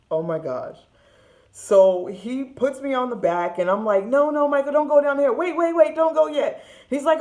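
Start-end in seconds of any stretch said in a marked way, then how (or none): 4.0-6.6 higher and louder, frantic begging; mock panicked little sister pleading. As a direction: beg him to stop before the drop. Begging brother not to ride.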